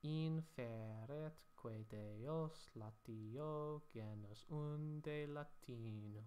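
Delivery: slow, measured chanting of Latin verse; slow scanned Latin reading; Latin verse recitation. Shown as a demonstration. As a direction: read it out like an ancient poet, syllable by syllable. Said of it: "it reads like so".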